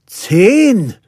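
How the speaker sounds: high, loud announcement; excited call. A narrator's reading. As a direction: call out the new count. Nanny calls the rising count. (aufgeregt ausrufend)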